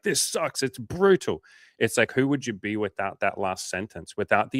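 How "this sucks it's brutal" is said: high, whiny mock lament; mock whiny complaint. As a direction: whine it like the world is ending. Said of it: "be like".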